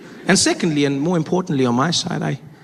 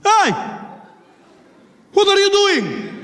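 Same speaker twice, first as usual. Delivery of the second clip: high, sharp call-out; challenging passenger; slower than usual. Acted. Passenger opposite the bomber confronting him, indignant shout.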